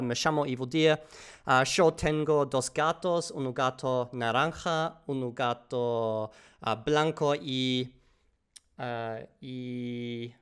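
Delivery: slow, halting learner Spanish with Argentinian intonation; hesitant beginner speaking Argentinian Spanish; learner Spanish test. Shown as a demonstration. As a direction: recite slowly, searching for each Spanish word. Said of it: "let's do a little bit of a test".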